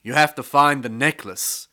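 king's command. A narrator's reading. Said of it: "said the king".

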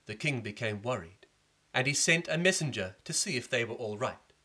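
ordinary delivery

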